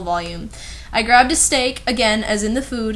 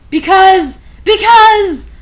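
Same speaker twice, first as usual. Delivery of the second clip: high, loud dramatic because; milk the suspense loudly; mock-dramatic buildup; hammed-up build; 9 semitones higher, 11 dB louder, much slower than usual.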